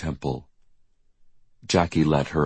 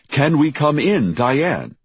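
raised, louder call with a falling end; reporter on television; 11 semitones higher, 5 dB louder than usual. A narrator's reading. Reporter's voice repeated from the television, calling. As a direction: project it high, dropping on the name. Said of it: "the same voice repeated from the television".

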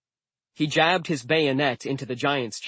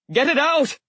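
much higher, loud pained yell; 14 semitones higher, 6 dB louder than usual. A narrator's reading. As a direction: yell it out through the pain. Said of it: "he yelled".

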